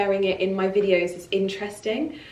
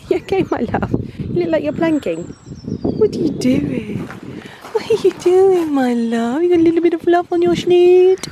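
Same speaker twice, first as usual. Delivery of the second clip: talking to a dog; 9 semitones higher, 6 dB louder than usual. Real, not acted.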